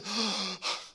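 mock gasp. Waking from death, eyes open.

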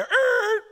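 loud, high imitated tire screech; sustained high-pitched "errr" skid noise. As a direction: make a squealing brake sound with your voice. Imitates car pulling over.

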